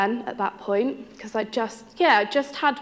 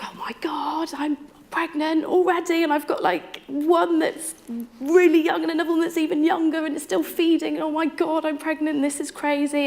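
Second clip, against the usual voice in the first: panicked excitement; 7 semitones higher than usual. Retold or imitated. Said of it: "I was like".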